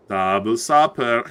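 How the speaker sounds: "Double supper" low and slow; low drawn-out echo. Pretend. Caster savouring his guess before laughing, low slow echo.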